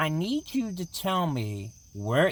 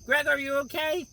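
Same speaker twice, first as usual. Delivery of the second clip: ordinary delivery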